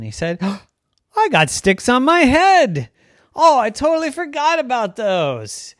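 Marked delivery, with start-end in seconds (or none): 1.2-5.7 high, loud delighted discovery; like finding a hidden treasure; excited. Acted. Deer discovers his antlers.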